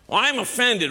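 high, hammed-up indignation; mock offended. Preacher mimics people looking for offense, high mock-indignant voice. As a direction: imitate someone easily affronted.

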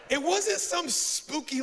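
normal talking voice

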